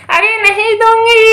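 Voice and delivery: drawn-out, cackling witch refusal; taunting witch. Pretend. Witch refuses to give children back, taunting drawl. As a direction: stretch it out tauntingly between cackles.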